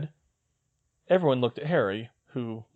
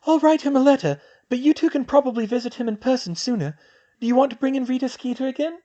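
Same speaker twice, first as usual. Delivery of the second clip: put-on higher young woman's voice, brisk; girl's voice; 14 semitones higher, 5 dB louder than usual. Hermione planning with Luna, in a higher girl's voice. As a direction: voice the girl, a clear step up in pitch. Female character voiced high.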